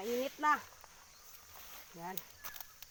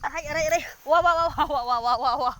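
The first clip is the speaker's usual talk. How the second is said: real startled shouting, rapid repeated cries; alarmed yelling; 3 semitones higher, 12 dB louder, faster than usual. Real, not acted. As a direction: cry out as the animal moves under you. Startled while mounting the carabao.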